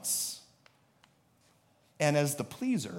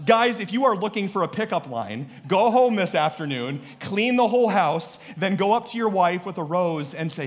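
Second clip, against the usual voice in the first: ordinary delivery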